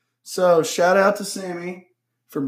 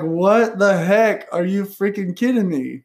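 higher, drawn-out incredulous outburst; mock disbelief; 5 semitones higher, slower than usual. Recipient retelling finding whiskey on the porch, in exaggerated disbelief. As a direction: play up the stunned exclamation, stretching the words. Retelling her stunned reaction to a gift.

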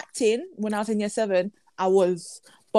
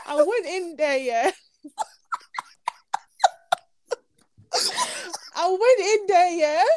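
nervous laughter; 7 semitones higher, slower than usual. Real, not acted. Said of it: "I shouldn't laugh".

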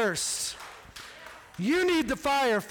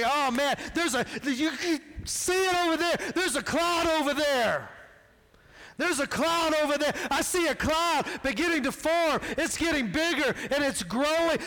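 servant bursting with excitement, higher and pitched up; excited servant; 6 semitones higher than usual. Acted. Servant excitedly tells Elijah the cloud is there, higher and thrilled. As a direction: gasp it out like he finally sees the cloud.

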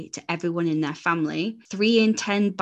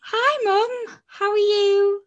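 overly sweet; 4 dB louder, slower than usual. Retold or imitated. Nervous call to mum.

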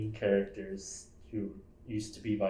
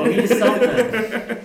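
laughing; 14 semitones higher, 17 dB louder than usual. Real, not acted. Cracking up at the joke.

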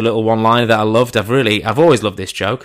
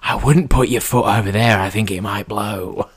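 mine helper. Retold or imitated. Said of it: "just going".

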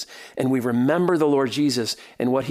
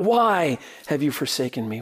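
ordinary talk, nothing marked

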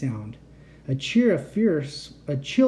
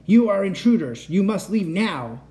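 loud, higher robot order, slowing at the end; robot command; 5 semitones higher, 4 dB louder than usual. Acted. Robot guard orders the intruders out, loud. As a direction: bark it out like a guard machine.

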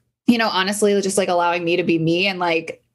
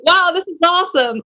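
high, loud burst of delight; excited self-quote; 10 semitones higher, 5 dB louder than usual. Retold or imitated. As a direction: act out being thrilled, pitched up and louder. Retelling her upbeat morning mood.

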